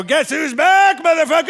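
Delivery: hammed-up boast; triumphant yell. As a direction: announce your victorious return at full volume. Triumphant return at drive-thru.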